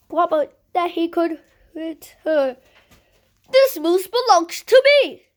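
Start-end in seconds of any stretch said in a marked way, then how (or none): 3.5-5.3 high, loud insistence; firm claim. Acted. Wilford insisting to the old lady, high and loud. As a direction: declare ownership loudly. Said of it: "he explained".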